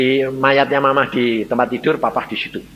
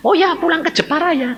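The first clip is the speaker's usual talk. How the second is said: high-pitched eager question; eager surprise. Acted. Father asking his dying wife if she means home, eagerly surprised. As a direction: pitch it up, brightly taken by surprise.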